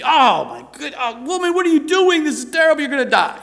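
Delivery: high, loud, hammed-up scandalized shock; mock horrified outburst. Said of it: "He didn't say".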